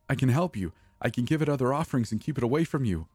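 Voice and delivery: high, fast frantic bargaining; desperate plea. A narrator's reading.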